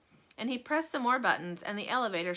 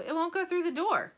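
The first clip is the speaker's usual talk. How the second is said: worried protest; 9 semitones higher than usual. A narrator's reading. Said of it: "said Grandpa Joe".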